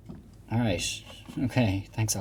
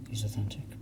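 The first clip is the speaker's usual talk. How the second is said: whispering; 10 dB quieter than usual. Real, not acted. Aside while reading game text.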